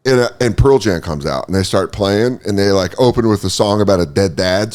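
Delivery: ordinary delivery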